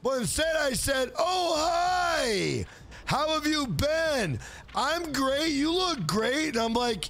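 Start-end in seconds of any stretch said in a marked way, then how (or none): 1.2-6.5 overly bright fake-polite greeting, higher; fake friendly. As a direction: put on a sugary fake cheer for her.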